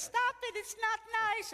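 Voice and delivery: very high, loud protest; scolding. Retold or imitated. Widow telling laughing friends off, scolding. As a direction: imitate a scandalized old lady crying out.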